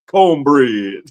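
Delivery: low, drawn-out country twang; cornbread country accent. Retold or imitated. Preacher teases Tori's cornbread accent, mock country drawl. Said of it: "She got that cornbread".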